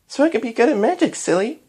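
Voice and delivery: put-on high, quick child voice, playful; giggly. A narrator's reading. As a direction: tease lightly as a little girl. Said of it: "giggled Twilight".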